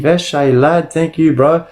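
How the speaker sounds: normal talking voice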